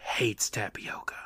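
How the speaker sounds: hushed whisper aside. Acted. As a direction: whisper it like a secret. Dramatic aside about tapioca.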